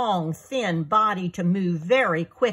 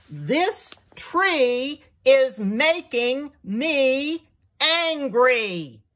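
higher, louder, slow angry growl; angry lion; 6 semitones higher, 3 dB louder, much slower than usual. A narrator's reading. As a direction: drag it out in anger.

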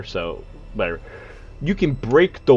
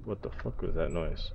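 ordinary delivery

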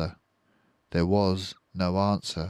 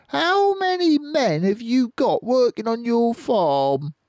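high, louder question; high farmer voice; 7 dB louder than usual. Acted. Bean asking the farmers, high and loud. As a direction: ask like a squeaky boss.

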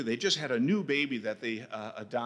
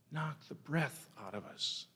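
hushed; 3 semitones higher, 8 dB quieter than usual. Acted. Hushed repetition after tragedy.

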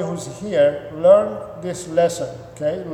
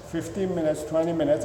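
usual speaking voice

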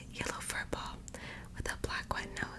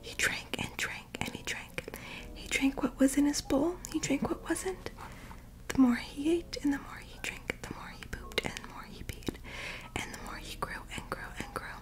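whispered storytelling; 4 dB louder than usual. Acted. Reading Marley's story to sleepy listeners, in a whisper. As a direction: breathe the story out like a bedtime read.

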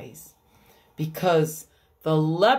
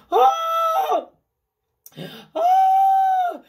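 very high, loud squealing shriek; overjoyed man spotting the crowd; squeaky scream; 7 dB louder than usual. Acted. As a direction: let a happy cheer crack into a squeal. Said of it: "escaped in a squeaky scream".

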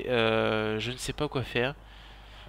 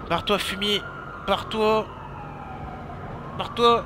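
high, loud shouts to clear off; angry yelling; 8 semitones higher, 4 dB louder than usual. Real, not acted. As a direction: yell at a pursuer, rising higher. Chasse un poursuivant. (crier avec colère, voix qui monte)

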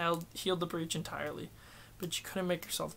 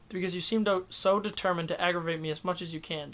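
plain reading voice

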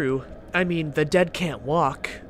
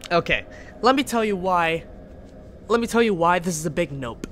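normal talking voice

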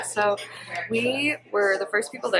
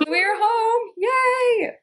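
excited cheer; 13 semitones higher, much slower than usual. Real, not acted. Vlogger back home with the console, excited cheer. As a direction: cheer in a high, drawn-out pitch.

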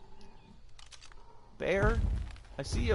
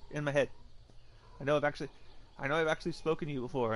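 ordinary talk

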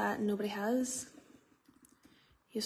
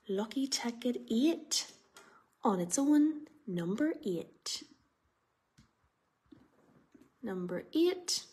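bingo caller. Real, not acted. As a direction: linger on the number like a bingo host. Raffle number call.

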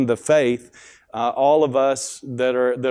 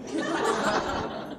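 soft laugh; 10 dB quieter than usual. Real, not acted. Laughs at own joke.

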